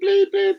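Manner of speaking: high beeping self-censor; high censor bleep. Pretend. Streamer censors his own words with a high beep. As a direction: bleep the items out in a high squeak.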